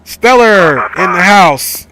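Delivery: loud, slow, drawn-out hype greeting; excited shout. Real, not acted. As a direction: call it out like announcing a star. Greeting a viewer arriving on stream.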